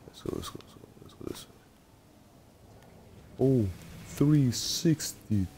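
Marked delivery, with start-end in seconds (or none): 0.1-3.4 whispering. Real, not acted. Creeping through the map.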